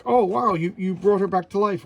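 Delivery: high, loud mock amazement; amazed cheer. Onlookers cheer the hero for reviving the woman, amazed. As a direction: gush over the miracle. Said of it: "And like".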